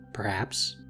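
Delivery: ordinary delivery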